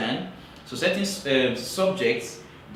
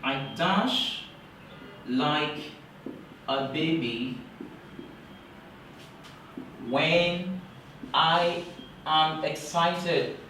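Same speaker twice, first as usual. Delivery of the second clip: 1 slow dictation; 3 semitones higher, much slower than usual. Shown as a demonstration. Teacher dictates a sample sentence.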